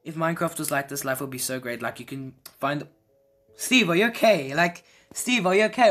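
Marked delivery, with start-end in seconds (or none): 3.5-5.9 higher, louder put-on worry; mock concern. Gamer teasing Steve again, mock worried.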